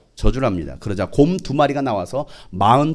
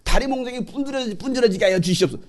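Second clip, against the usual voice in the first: higher, pleading voice; mock prayer; 9 semitones higher than usual. Preacher imitating a vengeful prayer, higher mock pleading. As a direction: plead to God like a petty believer. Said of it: "험악한 기도를 해요".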